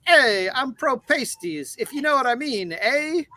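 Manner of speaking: a light mock Italian wise guy, teasing; hammed-up Italian accent. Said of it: "stopped doing the accent".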